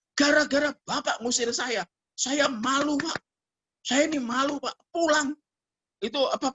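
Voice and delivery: high-pitched plaintive recounting; imitated upset girl. Evicted girl telling the pastor her shame, imitated plaintive voice. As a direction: pour out the grievance, voice held high. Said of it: "nada saya langsung turun".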